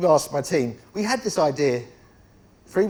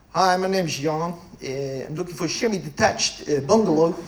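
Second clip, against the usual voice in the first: a lightly put-on Dutch accent. Agent posing as a Dutch buyer, mock Dutch accent. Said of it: "I could do a half-decent Dutch accent".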